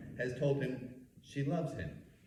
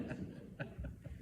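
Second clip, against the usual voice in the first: breathy laugh after joke; 11 dB quieter than usual. Real, not acted. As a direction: let out a laugh.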